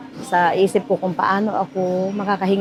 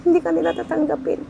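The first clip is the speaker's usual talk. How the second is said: tearful, high and choked up; crying; 8 semitones higher than usual. Real, not acted. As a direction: voice breaking into tears, real. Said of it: "Naiyak ako kasi".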